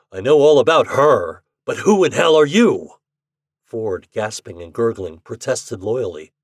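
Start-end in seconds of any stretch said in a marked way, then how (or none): 0.1-2.9 loud, raised, menacing demand; angry challenge. A narrator's reading. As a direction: challenge him with mounting force. Gunman angrily challenges Ford.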